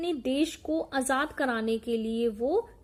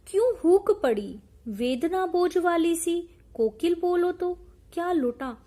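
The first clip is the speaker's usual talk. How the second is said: raised, sing-song reciting of verse; poem recitation; 4 semitones higher, 4 dB louder than usual. Acted. Teacher reciting the poem to the class, in recitation voice. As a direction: declaim the lines to the class.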